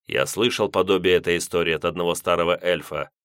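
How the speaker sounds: usual speaking voice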